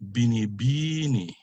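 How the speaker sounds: BINIBINI with long stressed second BI; penultimate stress. Shown as a demonstration. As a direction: stretch the third syllable of BINIBINI. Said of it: "second to the, to the last syllable".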